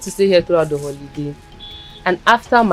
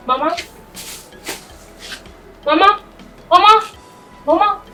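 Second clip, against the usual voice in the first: worried calling; 7 semitones higher, 4 dB quieter than usual. Acted. Girl finds grandmother not answering.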